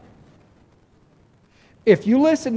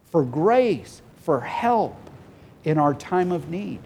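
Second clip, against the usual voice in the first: usual speaking voice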